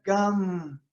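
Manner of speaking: "Gam" said slowly with a full, voiced G; fuller Ka sound. Shown as a demonstration. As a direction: give "Gam" a slow, full-bodied voiced G and a long nasal end. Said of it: "quando ele é um pouco mais assim... Encorpado". ("Gam" pronunciado devagar, com o G sonoro e encorpado)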